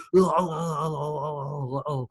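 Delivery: Austrian-accented strongman chuckle; Arnold laugh. Shown as a demonstration. Host doing Arnold's laugh mid-impression. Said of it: "you know what his laugh is?".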